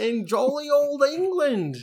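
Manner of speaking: slow, high, hammed-up English accent; mock posh English. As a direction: drawl it like a jolly old Englishman. Jolly old England put-on accent.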